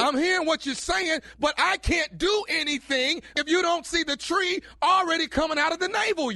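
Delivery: high, loud shouting back. Acted. Prank caller shouting back.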